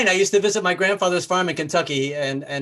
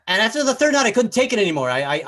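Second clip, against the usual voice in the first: ordinary talk, nothing marked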